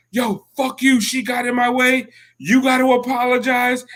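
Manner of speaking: high-pitched mock yell; intense, indignant tirade, imitated; retold screaming. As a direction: re-enact a heated demand, pitch way up but volume held back. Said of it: "And I'm screaming at him".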